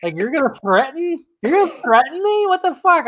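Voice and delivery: high, incredulous mock outrage; Superman's disbelief. Host acts out Superman threatened by Batman, high mock incredulity.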